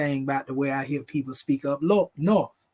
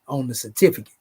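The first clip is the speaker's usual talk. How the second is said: ordinary talk, nothing marked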